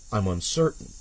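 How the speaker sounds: usual speaking voice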